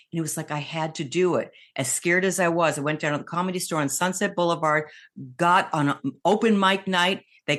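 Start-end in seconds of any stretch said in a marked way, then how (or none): none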